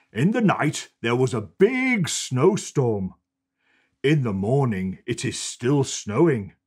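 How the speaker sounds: bright storybook reading voice; cosy storybook narration; reading to children. A narrator's reading. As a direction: read it like a bedtime picture book. Reads picture book aloud.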